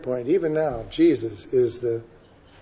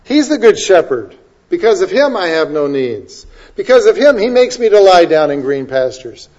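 fervent preaching; 4 semitones higher, 6 dB louder than usual. Acted. Preaching Psalm 23 climax.

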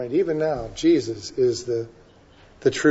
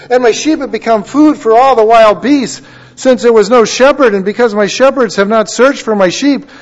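rising to a loud, high preaching cry; preaching scripture reading; 5 semitones higher, 5 dB louder than usual. Acted. Preacher reading Ezekiel to congregation, loud proclamation. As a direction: proclaim the prophecy with mounting force.

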